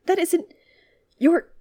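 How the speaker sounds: flustered, halting, pitch jumping up; stammering. A narrator's reading. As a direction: stammer out indignant fragments. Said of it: "Obi-Wan stammered".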